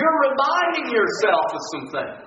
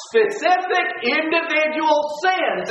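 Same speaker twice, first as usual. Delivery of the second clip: normal talking voice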